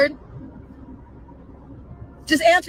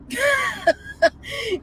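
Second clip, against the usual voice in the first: high laugh close to tears; high crying laugh; 10 semitones higher than usual. Real, not acted. Vlogger breaks into high crying laughter. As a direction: laugh till it cracks.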